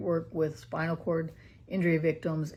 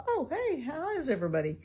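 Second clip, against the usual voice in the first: bright, much higher chirpy greeting; mock cheerful waking; 8 semitones higher than usual. Mother mimics son suddenly waking, lightly cheerful. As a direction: pretend he just breezes awake. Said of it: "wake up someday and go".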